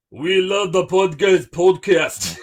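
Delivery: slow, hammed-up Russian accent; thick mock-Russian fan voice. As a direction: do your best comic Russian. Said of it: "In your best Russian accent".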